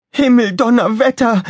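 very high, loud exclamation; Lexi exclaiming. A narrator's reading. Pilot sees the tornado closing in, high cry. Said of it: "rief Lexi vom Flugpult".

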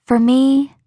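Rachel's voice. Acted. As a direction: nudge her gently toward the dance.

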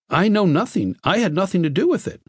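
high, nervous protest; panicky denial. A narrator's reading. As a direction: plead innocence in a high voice. Questioned passenger denies all, terrified.

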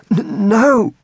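high, stammered denial; panting stammer. A narrator's reading. Exhausted captive answering her, high panting stammer. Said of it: "he managed, still panting".

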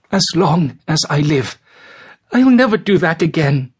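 put-on high, tearful boy's voice; between sobs. A narrator's reading. George alone on his bed after the caning, vowing between sobs. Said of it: "promised himself between sobs".

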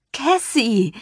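high, excited cry of a friend's name; shrieking greeting. A narrator's reading. Taylor greets her friend at the door, excited shriek. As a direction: squeal her name in delight. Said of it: "Taylor warf kreischend die Arme hoch".

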